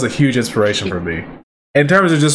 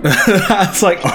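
laughing; 7 semitones higher, slower than usual. Real, not acted. Laugh heard while retelling.

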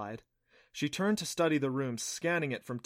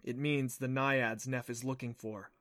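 plain reading voice

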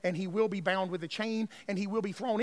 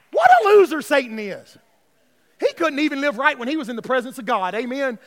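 loud, driving preacher's delivery; full-force preaching; 6 semitones higher, 12 dB louder, faster than usual. Real, not acted. Preacher scorning Satan for condemning stumbling believers, loud driving preaching. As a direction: hammer it home to the congregation.

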